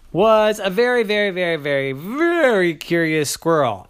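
emphatic storyteller build; piled-up emphasis. Acted. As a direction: stress on very, very, very, very, very.

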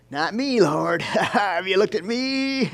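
laughing, high and slow; mock pious protest. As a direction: poke fun at religious pride. Mocks self-righteous believer.

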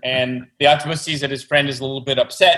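normal talking voice

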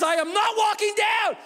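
dad yelling he won't walk down; exasperated yell. Retold or imitated. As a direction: yell it at the kids. Shouted quote at the top.

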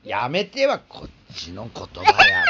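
loud and slow, half joking; slow loud scolding. Real, not acted. Scolding child who pinches.

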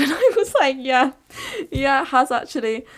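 high, loud laughing through the words; real laughter while agreeing. Real, not acted. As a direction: giggle as you admit it. Laughing while admitting it backfired.

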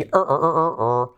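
Er held like an English r sound; Er with a rounded, u-like r; drawn-out English r. Shown as a demonstration. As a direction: hum a long English r. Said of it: "La R que siempre trae aparejada una U".

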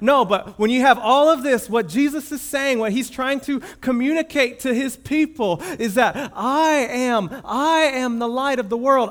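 loud, high, driving preaching voice; fervent preaching. Acted. Preacher proclaiming Jesus's words to the congregation, fervent and loud. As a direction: build it up and proclaim it.

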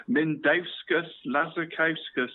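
Mindauskas Lazarkauskas drawn out, syllable by syllable; slow name repeat. Shown as a demonstration. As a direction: lay out Mindauskas Lazarkauskas slowly. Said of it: "one more time".

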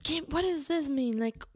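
mock-demanding rapid-fire questions; pushy questioning. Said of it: "not to go full".

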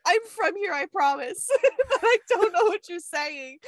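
high, laughing mock-desperate apology; pleading apology. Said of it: "I'm like".

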